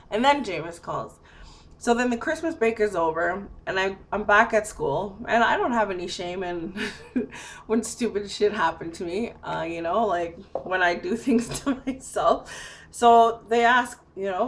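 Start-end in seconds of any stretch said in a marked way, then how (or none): none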